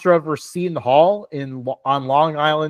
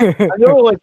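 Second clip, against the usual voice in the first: laughing; 6 semitones higher, 7 dB louder than usual. Real, not acted. Laughing at own answer.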